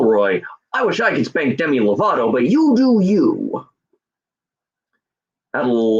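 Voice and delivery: usual speaking voice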